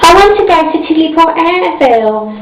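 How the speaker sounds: eager and high, bubbling over; excited. A narrator's reading. Miss Star tells the bus driver, excited and high. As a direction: blurt it out like a thrilled little girl. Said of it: "said little Miss Star excitedly".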